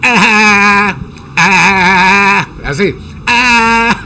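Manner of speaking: a bleating A like a goat. Shown as a demonstration. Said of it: "como las cabras". (A como cabra, balando)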